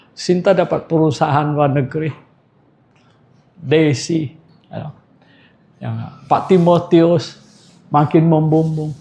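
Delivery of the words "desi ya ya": ordinary delivery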